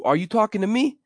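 high, pushy grab for attention; cocky, insistent questioning; attention grabber. Shown as a demonstration. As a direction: demand they listen to you. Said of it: "That's akin to".

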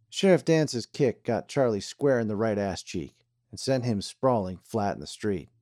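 plain reading voice

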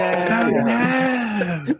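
squeaky high "oh no"; Family Guy character voice. Retold or imitated. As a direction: pitch way up for a cartoon cry. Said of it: "that character from Family Guy".

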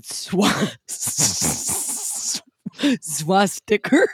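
slow, laughing repeat of swasticker; giggling slow swasticker; laughing mimicry. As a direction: tease the slip through a laugh. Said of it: "But you said".

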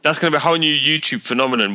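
usual speaking voice

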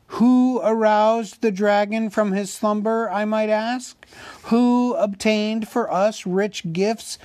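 high, indignant public oration; loud and clear. A narrator's reading. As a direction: ring out each accusing question to the crowd. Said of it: "spoke very loud and clear".

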